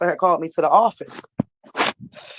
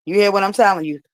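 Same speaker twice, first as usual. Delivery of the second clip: ordinary delivery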